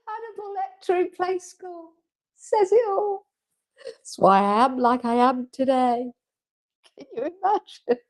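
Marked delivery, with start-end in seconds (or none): 0.1-3.3 laughing. Real, not acted. Joking through laughter.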